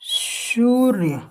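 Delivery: सूर्य stretched very slowly; teacher slowly sounding out सूर्य; drawn-out pronunciation. Shown as a demonstration. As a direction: draw out the first syllable of सूर्य. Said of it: "ये क्या है?".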